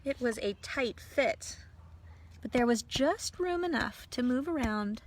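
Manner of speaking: usual speaking voice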